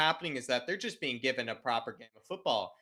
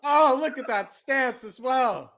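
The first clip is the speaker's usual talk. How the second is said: excited shouting; 10 dB louder than usual. Real, not acted. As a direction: bellow it out in delight at the manager. Cheering the manager's celebration.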